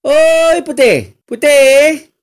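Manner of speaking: Malay stepmother yelling for Putih; stepmother calling in Malay. Retold or imitated. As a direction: holler the name across the yard. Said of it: "if I tell in Bahasa Melayu".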